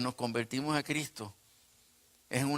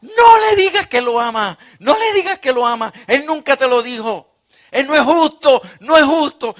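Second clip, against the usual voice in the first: frantic yelling, much higher and much louder; tormenting voices screaming at the preacher not to write it; screaming inner voice; 13 semitones higher, 18 dB louder than usual. Retold or imitated. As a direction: shriek it like a voice assaulting your mind. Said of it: "si me estuviesen gritando".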